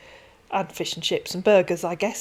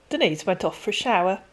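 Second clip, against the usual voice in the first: ordinary delivery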